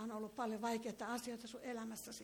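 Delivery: ordinary delivery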